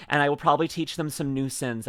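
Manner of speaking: ordinary talk, nothing marked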